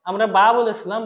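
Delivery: usual speaking voice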